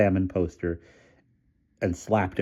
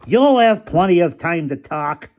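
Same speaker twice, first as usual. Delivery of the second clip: higher, louder, slow shout; shouting over the din; 11 semitones higher, 7 dB louder than usual. A narrator's reading. Featherstone shouting at Sturgeon over the crowd noise. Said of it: "shouted Featherstone over the din".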